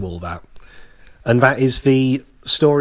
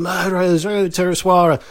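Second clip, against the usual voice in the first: mock crowd shouting; 6 semitones higher than usual. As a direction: imitate an accusing crowd at half volume. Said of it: "so, so everyone's shouting".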